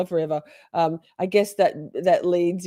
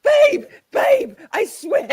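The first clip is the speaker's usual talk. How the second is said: high pleading, breaking into laughs; laughing plea; 14 semitones higher, 6 dB louder than usual. Retold or imitated. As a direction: beg through laughter. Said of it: "She's like".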